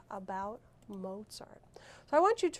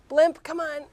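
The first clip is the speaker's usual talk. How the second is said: loud, higher summoning call; 6 semitones higher, 10 dB louder than usual. Pretend. Teacher calling the blimp to find a book, calling out. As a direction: call the blimp over, loudly.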